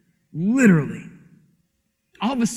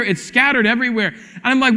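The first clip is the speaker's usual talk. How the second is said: ordinary talk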